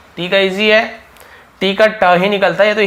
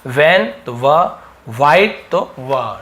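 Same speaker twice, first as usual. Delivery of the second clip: वैन and white said slowly, व held; slow teacher drill on व; slow sound demo; 2 semitones lower, much slower than usual. Shown as a demonstration. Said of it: "व ध्वनि निकल रहा है". (व ध्वनि को धीरे-धीरे समझाते हुए)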